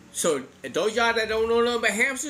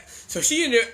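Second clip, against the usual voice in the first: usual speaking voice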